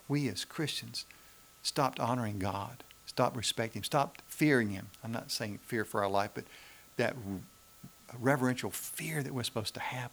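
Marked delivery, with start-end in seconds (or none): none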